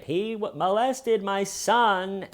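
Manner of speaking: high, slow mock-desperate plea; desperate Diana. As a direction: wail it like a desperate mother. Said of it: "believes Diana's desperate".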